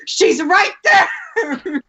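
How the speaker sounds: mock fangirl yell, drawn out; excited shout. Excited retelling of spotting celebrity.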